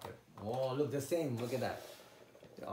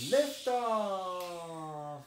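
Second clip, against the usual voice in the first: high, loud, long-drawn "Lift off"; playful launch call; 5 semitones higher, 5 dB louder than usual. Pretend. Parent launching the child's toy rocket, high drawn-out call. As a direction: sing it out as the rocket rises.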